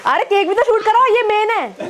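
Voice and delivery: high-pitched eager call; excited urging. Real, not acted. Child urging family to film the cake, excited. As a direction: call out over the party, insistent.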